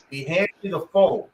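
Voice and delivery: ordinary delivery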